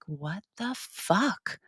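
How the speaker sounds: very slow, soft disbelief; stunned disbelief. Retold or imitated. Customer told Starbucks closes in five minutes, slow stunned disbelief. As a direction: drag each word out quietly, dumbfounded. Retold reaction to café closing early.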